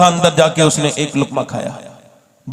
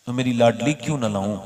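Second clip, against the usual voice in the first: tender father; 4 semitones lower, 6 dB quieter than usual. Acted. Father tenderly asks daughter.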